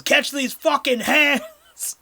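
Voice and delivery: loud high slow battle cry; battle shout. Acted. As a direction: yell it as you open fire. GM voices Kate blazing guns.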